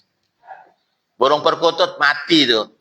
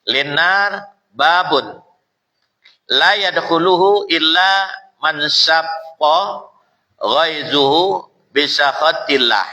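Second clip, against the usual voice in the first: hadith recitation; slower than usual. Acted.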